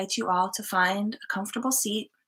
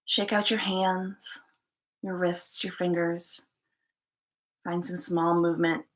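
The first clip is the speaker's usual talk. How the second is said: unhurried meditation guide, long pauses between short phrases; calm, spacious settling-in instructions; guided meditation. Acted. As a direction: gently guide them to settle in, leaving space after each instruction.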